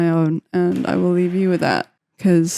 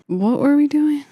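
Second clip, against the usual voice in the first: higher put-on voice, confused; mock bewildered voice; 7 semitones higher than usual. Producer playing confused mid-session in a silly voice. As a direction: play dumb in a funny voice.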